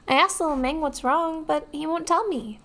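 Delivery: put-on high, sweet kid voice; childish voice. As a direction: speak like a small child. Streamer voicing child NPC dialogue.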